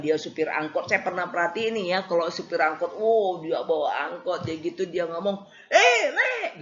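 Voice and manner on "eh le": high, loud laughing "Eh, Le"; laughing driver's holler; rough driver call. Retold or imitated. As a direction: shout it like a minibus driver hailing a mate. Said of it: "itu kan ngomongnya".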